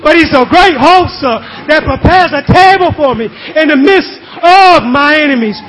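impassioned, rhythmic sermon delivery; full-force preaching. Real, not acted. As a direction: preach with fervour, punching key words.

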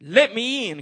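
high, loud wolf cry at the brick house; wolf crying out, a touch hammed. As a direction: call out like the wolf at the door. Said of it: "cried the wolf".

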